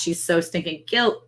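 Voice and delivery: ordinary talk, nothing marked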